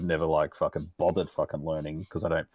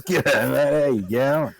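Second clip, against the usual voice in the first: high, louder cheery g'day; imitating a friendly bloke; 6 semitones higher, 5 dB louder, slower than usual. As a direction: repeat the oblivious mate's greeting, pitched up. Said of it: "he goes".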